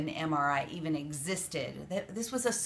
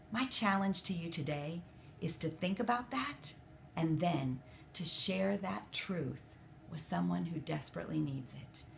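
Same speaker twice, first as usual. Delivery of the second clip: ordinary talk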